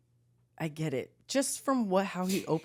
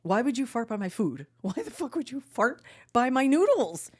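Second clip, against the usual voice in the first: retold anger; 6 semitones higher than usual. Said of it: "and I was mad".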